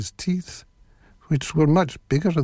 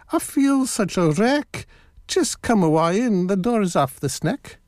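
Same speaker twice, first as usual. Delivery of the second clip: put-on high-pitched old granny voice; 6 semitones higher than usual. A narrator's reading. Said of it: "Granny said".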